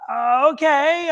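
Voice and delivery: high, slow, drawn-out agreement; reluctant compliance. Retold or imitated. Giving in to the spirit's order, reluctant. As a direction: imitate giving in warily, stretched and rising.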